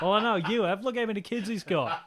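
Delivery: high, loud retort with laughter; mock indignant retort. Nurse retorting to patient's family, mock indignant. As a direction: fire back loudly, half laughing.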